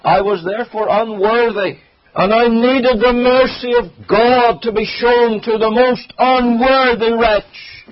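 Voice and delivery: high, rising plea that slows on "most unworthy wretch"; pleading psalmist. Retold or imitated. Preacher voicing David crying to God, high and pleading. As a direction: voice the psalmist begging for mercy, pitched high. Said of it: "He's saying".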